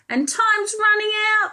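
high pleading voice; urgent plea, running out of time. Acted. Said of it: "pleaded Lolly".

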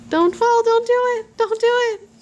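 high sing-song alarm; quick, high-pitched teasing plea; playful warning. Real, not acted. Mother watches baby about to topple, high playful warning.

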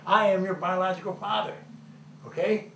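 loud, high-pitched angry insistence; furious, forceful confrontation. Acted. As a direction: raise your voice and lay down the law. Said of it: "you sound like you're angry".

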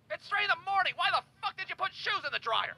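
angry Tom. Retold or imitated. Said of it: "Tom's retort".

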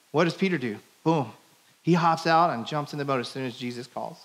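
normal talking voice

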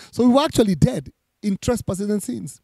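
ordinary talk, nothing marked

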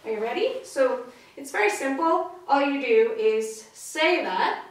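normal talking voice

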